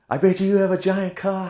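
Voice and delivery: high, loud joking holler; mock yelling. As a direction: yell an absurd compliment at a stranger. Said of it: "You're yelling like".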